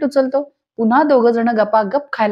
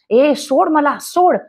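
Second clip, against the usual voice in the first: higher-pitched retold yell, little added volume; mild mock yell; 4 semitones higher, much slower than usual. Rekha, hair-pulling with Sakshi, demands release in a mild mock yell. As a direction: imitate an indignant yell without full force. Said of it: "जोर जोरात ओरडत असते".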